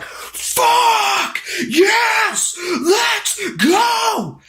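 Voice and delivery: very high, strained victory scream; triumphant yell. Retold or imitated. Gamer standing up as the fight ended, triumphant scream. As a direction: reenact a huge win, each word drawn out. Celebrates beating the boss.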